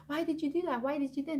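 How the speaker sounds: high-pitched imitation of a panicking child; retold frantic, repeated demand; freaking out. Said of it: "just freaking out".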